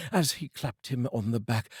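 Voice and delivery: ordinary delivery, nothing marked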